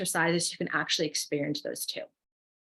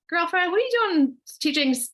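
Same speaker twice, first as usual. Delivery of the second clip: high-pitched mock incredulity; imitated friendly disbelief; 10 semitones higher, 4 dB louder than usual. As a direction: quote a friend calling you out, high-pitched. Retells a friend's challenge to her.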